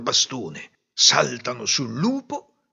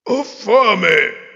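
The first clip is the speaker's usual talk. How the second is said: wolf voice; 7 semitones higher, 8 dB louder than usual. Acted. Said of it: "ripeté".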